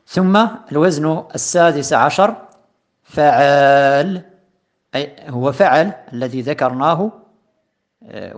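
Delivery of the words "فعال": فعال stretched very slowly and said louder; drawn-out emphasis. Shown as a demonstration. Teacher stressing the pattern فعال for students, drawn out. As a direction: lean on فعال, long and loud. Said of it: "teacher pronounces the new pattern".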